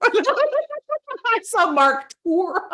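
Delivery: high-pitched, loud laughing speech. Real, not acted. Teacher tickled at the audience all answering "tour", laughing. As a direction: talk through a real burst of laughter.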